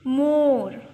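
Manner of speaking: "मोर" loud, vowel held open; teacher modelling the English word "मोर"; emphasised O sound. Shown as a demonstration. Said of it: "phonic sound पर ध्यान दें".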